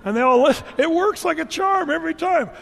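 high, loud burst after a hush. Shown as a demonstration. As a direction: belt it out over the class. Mimes loud talk after hush.

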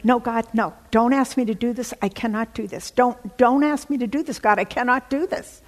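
loud, high, panicked begging; retold frantic prayer to God; desperate pleading prayer. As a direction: plead with God not to ask this. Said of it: "microsecond prayers".

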